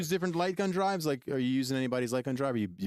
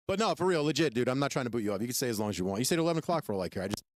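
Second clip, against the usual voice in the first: normal talking voice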